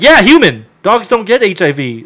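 loud, high impatient retort; acted exasperated shouting; exasperated correction. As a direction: raise the voice to correct him.

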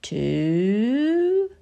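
high, drawn-up count; rising count. Acted. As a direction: build suspense on the count. Counting for Pooh Sticks.

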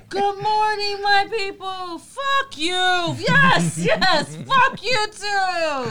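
very high, loud joyful shouting; Coming to America impression. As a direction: holler a gleeful greeting to the whole street. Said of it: "Coming to America when he goes".

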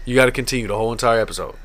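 ordinary talk

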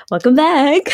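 high, lilting singsong greeting; American podcast hosts' intro. Retold or imitated. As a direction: echo the American hosts' perky welcome, mockingly. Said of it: "this is exactly how they talk".

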